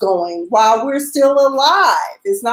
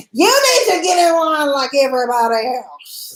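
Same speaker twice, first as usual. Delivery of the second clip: mock yell of an irate customer; retold scream; 3 semitones higher than usual. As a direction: imitate a woman screaming out in a line. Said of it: "gonna scream out".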